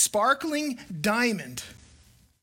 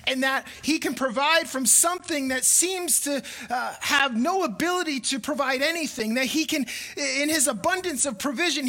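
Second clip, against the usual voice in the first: raised, earnest preacher's voice; preaching; 5 semitones higher than usual. Acted. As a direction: lift the congregation with the promise.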